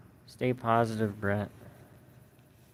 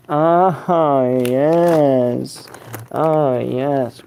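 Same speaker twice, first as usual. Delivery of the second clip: loud, drawn-out delight; excited relish; 3 semitones higher, 12 dB louder, much slower than usual. Real, not acted. Streamer showing off the ball that always comes back, loudly thrilled. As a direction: stretch it out with big pleasure.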